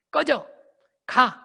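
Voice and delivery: high, loud short barks; curt yelled orders. Shown as a demonstration. Quarreling person shuts the other down, loud yells. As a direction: hurl each word like a war command. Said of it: "이게 다 전쟁하는 사람들이에요".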